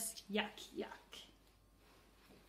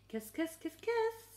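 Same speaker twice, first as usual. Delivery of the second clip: playful; 8 semitones higher, 4 dB louder than usual. A narrator's reading. Aunt smothering with kisses.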